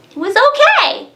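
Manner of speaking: "okay" swooped way up high and let fall; a mock chirpy shrug, pitched far up; sing-song okay. Repeats verdict in sing-song.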